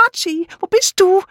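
very high, plaintive woman's voice; anxious calling. A narrator's reading. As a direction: call out for Archie in a high voice. Frightened woman calls in darkness. (hoch und ängstlich rufend)

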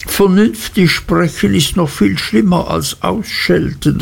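put-on high, despairing young wife; a lightly voiced, desperate girl; desperate cry. A narrator's reading. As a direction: wail it out in despair. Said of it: "rief Dora voll Verzweiflung".

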